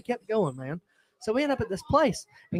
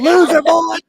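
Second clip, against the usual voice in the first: higher, loud taunting jeer; mock insult; 7 semitones higher, 10 dB louder, slower than usual. Homeless guy taunting Corey as he walks away, loud jeer.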